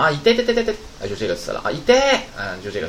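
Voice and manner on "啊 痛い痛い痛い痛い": high-pitched cartoon cry of 痛い痛い; anime-style "ouch". Teacher mimics anime characters, high cartoon-style squeal. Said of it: "动画片不老说吗".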